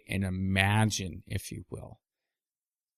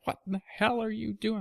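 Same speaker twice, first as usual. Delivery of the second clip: much higher, mock outraged old lady; furious grandmother; 11 semitones higher, 5 dB quieter, faster than usual. Grandmother after the ball hits the window, mock outrage. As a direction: ham up a grandma losing it over the window. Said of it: "Grandma lost her mind".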